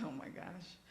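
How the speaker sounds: ordinary delivery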